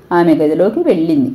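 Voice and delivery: usual speaking voice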